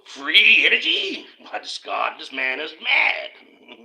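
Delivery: mock outrage at Tesla's free energy; scoffing tycoon. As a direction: play a pompous magnate aghast at the idea. Mocking the rich scoffing at Tesla.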